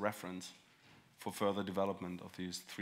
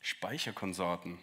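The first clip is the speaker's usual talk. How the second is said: ordinary talk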